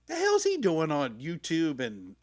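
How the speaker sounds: loud, slow disbelief; who is this guy, said with a big stretched out shout; shocked and loud; incredulous. Retold or imitated. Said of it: "I'm like".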